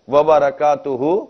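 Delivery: وبرکاتہ drawn out slowly with a rise; slow careful greeting. Shown as a demonstration. Preacher completes the full salaam, slowly. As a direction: stretch وبرکاتہ slowly, rising. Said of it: "سلام کرنا صحیح ہے".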